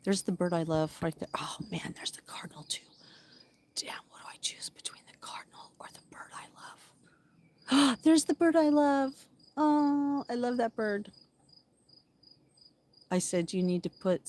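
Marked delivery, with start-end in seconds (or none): 0.0-8.0 soft whisper while birdwatching; whispering by the window to not scare birds. Real, not acted. 8.0-11.1 high, excited coo over a bird; thrilled at spotting her favourite bird; delighted gasp. Real, not acted.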